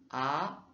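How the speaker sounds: "A" rising in pitch, second tone. Shown as a demonstration. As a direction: glide "A" upward. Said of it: "c'est un son montant".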